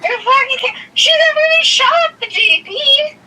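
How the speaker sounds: high-pitched burst of disbelief; outraged. Real, not acted. Daughter fighting sister's claim to the J P, outraged. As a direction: cut yourself off, voice leaping high.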